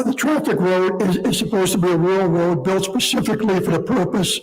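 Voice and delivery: ordinary talk, nothing marked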